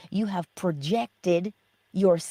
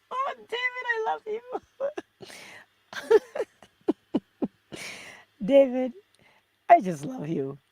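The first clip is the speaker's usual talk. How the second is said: high, giggly, near-tearful laughter through the words; real delighted laughter; laughing affection. Real, not acted. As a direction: laugh helplessly while telling him you love him. Laughing fondly at a viewer.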